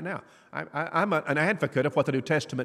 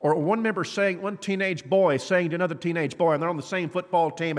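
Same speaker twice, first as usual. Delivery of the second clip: ordinary talk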